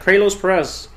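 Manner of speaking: Kralos as KRAY-lohs before Perez; botched Kralos Perez, KRAY-lohs; mispronounced name. Shown as a demonstration. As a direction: read Kralos wrong, with a long "ay". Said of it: "What if someone called you".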